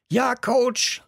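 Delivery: high-pitched eager team reply; class calling back. A narrator's reading. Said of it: "riefen die anderen wie aus einem Mund zurück". (im Chor hoch zurückgerufen)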